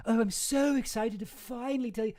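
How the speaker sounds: a sarcastic gushing socialite; mocking Ghislaine.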